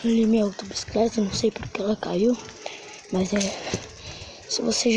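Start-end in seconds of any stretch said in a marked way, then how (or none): none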